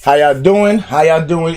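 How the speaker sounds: ordinary delivery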